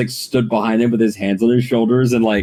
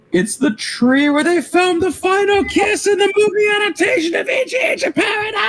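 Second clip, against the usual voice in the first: much higher, shouting, crying out in ecstasy; ecstatic shout. Acted. Kakashi reveals the tree, shouting up high in rapture. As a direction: scream the title like a holy revelation.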